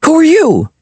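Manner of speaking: put-on high eager boy voice; bright curious kid asking; excited boy. A narrator's reading.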